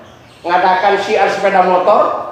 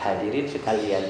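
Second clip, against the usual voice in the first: much lower and softer, no lift; flat low delivery; 10 semitones lower, 12 dB quieter than usual. Shown as a demonstration. Preacher showing students the dull version, low and soft. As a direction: say it dull and quiet. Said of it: "Itu kan sudah lain ya".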